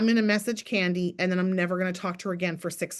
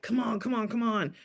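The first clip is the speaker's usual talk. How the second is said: pushy nagging. Retold or imitated. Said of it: "unrelenting has this vibe to it".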